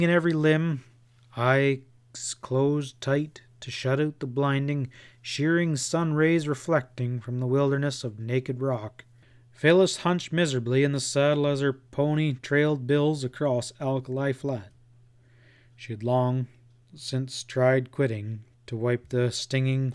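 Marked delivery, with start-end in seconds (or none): none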